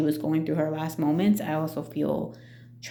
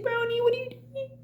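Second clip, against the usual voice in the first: tearful plea. Retold or imitated. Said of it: "calling to dying pet".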